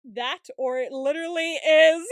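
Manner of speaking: loud, drawn-out, rising protest; excited rebuttal. Real, not acted. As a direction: insist loudly, stretching it out. Excitedly counters co-host's grooming theory.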